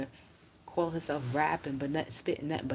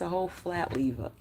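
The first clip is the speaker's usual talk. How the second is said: ordinary delivery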